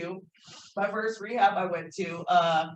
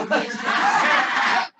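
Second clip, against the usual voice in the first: loud breathy laugh bursting out; high, loud unvoiced laugh; burst of laughter; 6 semitones higher, 10 dB louder than usual. Real, not acted. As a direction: laugh out loud at your own joke. Laughing at her own pun.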